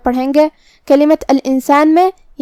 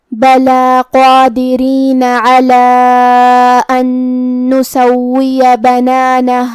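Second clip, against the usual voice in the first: slow, chanted tajweed recitation; Quran recitation; much slower than usual. Acted. Teacher reciting verses for the students. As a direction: recite the verses slowly and melodically.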